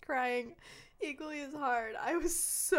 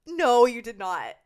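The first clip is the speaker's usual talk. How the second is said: loud, drawn-out shock; scandalized disbelief; 10 dB louder, slower than usual. Real, not acted. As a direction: stretch the first word in loud disbelief. Listener scandalized by the story.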